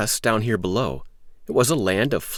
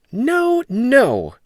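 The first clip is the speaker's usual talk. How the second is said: high, loud no from Jack; boyish refusal; 7 dB louder than usual. Acted. Jack refusing the beans, high and firm. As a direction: shake it off like a sharp boy.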